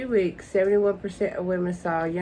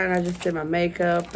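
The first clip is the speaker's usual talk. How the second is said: normal talking voice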